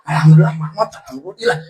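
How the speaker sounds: high, loud hammed-up thanksgiving with laughter; mock relief. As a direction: comically overdo the gleeful relief. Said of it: "Bukan gitu". (pura-pura girang, lantang dan tinggi)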